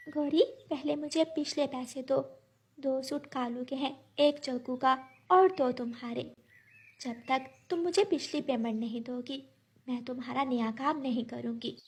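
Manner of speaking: lightly voiced firm little bird. Bird refusing the crow new work until paid, quietly firm. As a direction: hold firm in a small, soft voice.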